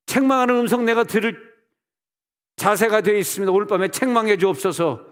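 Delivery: loud, high-pitched crying out to God; acted, impassioned plea; fervent prayer. Shown as a demonstration. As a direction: implore at full force as in prayer.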